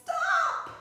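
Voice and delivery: very high, loud cry of alarm; shouting. Acted. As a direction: yell it to halt him. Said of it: "till the others cried".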